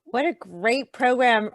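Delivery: normal talking voice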